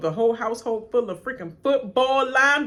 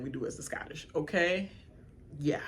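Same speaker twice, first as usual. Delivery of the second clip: ordinary talk, nothing marked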